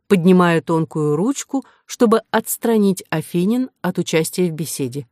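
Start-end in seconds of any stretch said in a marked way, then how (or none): none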